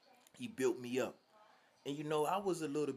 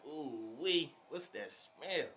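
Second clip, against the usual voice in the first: slow, higher drawn-out grossed-out ask; disgusted surprise; 3 semitones higher, much slower than usual. Retold or imitated. The kid smells the beaten man's fart, slow and grossed out. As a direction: stretch it out, grossed out.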